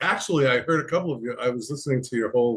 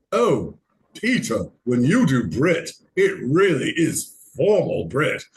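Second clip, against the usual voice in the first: put-on slow, aristocratic British accent; formal British accent; 4 dB louder, slower than usual. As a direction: imitate a friend in drawn-out, formal Brit. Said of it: "aristocratic. We, we don't talk like that".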